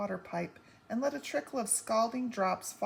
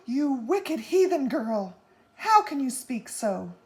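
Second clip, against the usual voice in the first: much louder, slow, shocked reproach; horrified scolding; 5 semitones higher, 9 dB louder than usual. A narrator's reading. Mother scolding her heathen daughter, loud and slow.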